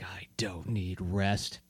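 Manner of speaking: slow, very soft whisper to oneself; mysterious whisper. Acted. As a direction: whisper it slowly and secretively. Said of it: "whispers it to himself".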